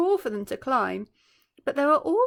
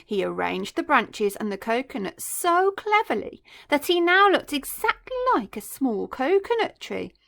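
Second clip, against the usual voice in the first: ordinary delivery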